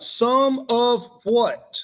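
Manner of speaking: drawn out, some of what; slow emphatic question. Shown as a demonstration. Teacher slowly quizzing class on the phrase. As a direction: stretch the question to make them think. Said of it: "What am I talking about?".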